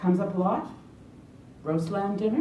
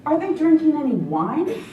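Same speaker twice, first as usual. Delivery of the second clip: incredulous; 7 semitones higher, 6 dB louder than usual. Real, not acted. Pointing out the missing wine.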